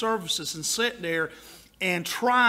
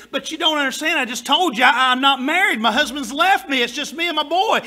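retold plainspoken mama; 4 semitones higher, 6 dB louder than usual. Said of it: "She said".

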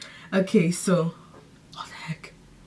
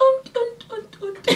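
deer grunting. Pretend. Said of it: "Where are these sounds coming from".

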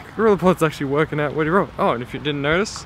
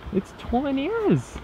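soft, high, drawn-out squeak; high-pitched squeal; 11 semitones higher, 5 dB quieter, slower than usual. Real, not acted. Vlogger coos over the tiny village, high soft squeal. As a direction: squeak it slowly in tiny-voiced delight.

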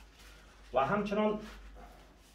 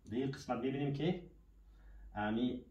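normal talking voice

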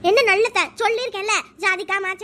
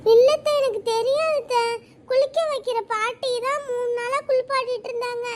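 normal talking voice

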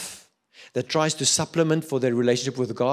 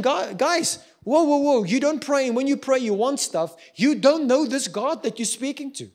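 much higher, slightly louder, imitating an exasperated God; God's mock exasperation; 11 semitones higher, 4 dB louder than usual. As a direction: stop them short like a fed-up friend. Voicing God's reply to prayers.

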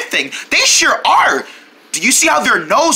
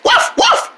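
barking like a dog. Pretend. Puppy barks.

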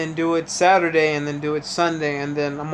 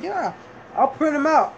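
high, sweet motherly voice; mom's voice; 9 semitones higher than usual. Retold or imitated. Son imitating his mom's offer, high and kind. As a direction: pitch up to play mom kindly.

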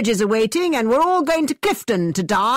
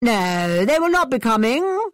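insisting; 4 semitones higher than usual. A narrator's reading. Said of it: "insisted Mister Thorpe".

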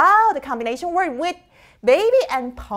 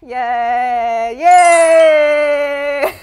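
drawn-out yay building to a shout; triumphant cheer; 6 dB louder than usual. Real, not acted. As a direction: cheer proudly, growing louder. Celebrates finishing the drawing.

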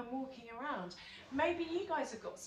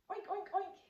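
pig oink; 7 semitones higher, 5 dB quieter, faster than usual. Shown as a demonstration. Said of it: "little piggy".

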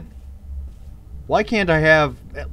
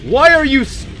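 outraged yell; 14 semitones higher than usual. Real, not acted. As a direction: blurt it out in disbelief. Hit again, outraged.

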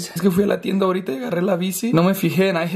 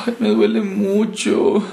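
ordinary talk, nothing marked

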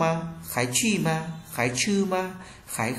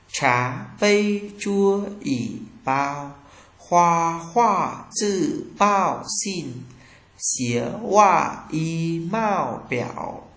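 very slow, syllable by syllable; teacher's deliberate, drawn-out model reading; slow pronunciation drill; 3 semitones higher, much slower than usual. Shown as a demonstration. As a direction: draw out each syllable for learners to copy.

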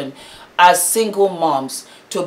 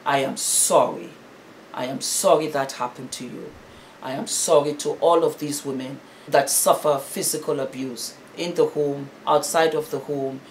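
softer, lower heartfelt sorry; soft sincere apology; 4 semitones lower, 6 dB quieter than usual. Real, not acted. Vlogger apologizing to abused women, soft and sincere. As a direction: gently say sorry to her.

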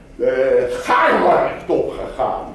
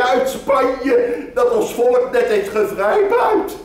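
very high, shouting market woman; loud fruit hawker; 9 semitones higher, 4 dB louder than usual. Acted. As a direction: cry the fruit to the merchant. Fruit seller hawking. (luid roepende fruitverkoopster)

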